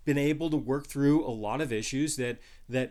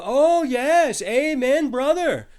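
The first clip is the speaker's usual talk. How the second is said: high, loud mock amen from the pews; cheering congregation; 9 semitones higher, 7 dB louder, slower than usual. Preacher mimics husbands cheering the verse, high and loud. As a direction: whoop it like a delighted churchgoer.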